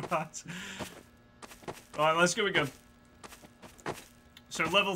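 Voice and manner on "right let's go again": ordinary talk, nothing marked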